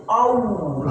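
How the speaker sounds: "Allah" with the lips pushed out; pouted Allah. Shown as a demonstration. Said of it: "Berarti bibir". ("Allah" dengan bibir monyong)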